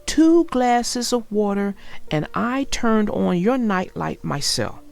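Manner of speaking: put-on clear, lively read-aloud for young children; storybook narration. A narrator's reading. Narrator reading Franklin's Blanket to young viewers, storybook read-aloud. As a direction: tell the story warmly to little listeners.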